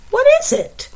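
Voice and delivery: squeaky high mouse voice; high sleepy mouse asking. Acted. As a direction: ask it like a small mouse.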